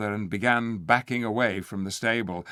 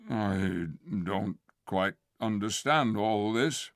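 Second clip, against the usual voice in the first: slow, slurring puzzled bear; slower than usual. Acted. The bear puzzling to the ape, slow puzzled voice. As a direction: puzzle it out slowly. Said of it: "in a slow, puzzled voice".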